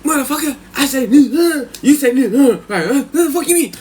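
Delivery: high, loud, muddled protest; imitated indignant cellmate. Cellmate insisting both sound alike, high indignant voice. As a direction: argue high and loud, half laughing. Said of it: "He said".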